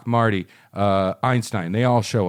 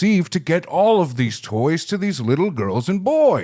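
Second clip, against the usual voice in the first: higher-pitched put-on storybook voice; Santa; 5 semitones higher than usual. Santa worrying about toys for children, higher character voice. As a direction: play the fretting gift-giver, pitched up. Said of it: "And then Santa's like".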